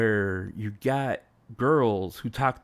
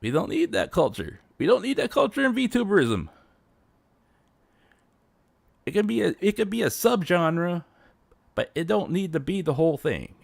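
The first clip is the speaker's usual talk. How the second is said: heated rant; 8 semitones higher, 4 dB louder than usual. Real, not acted. Ranting against idol culture.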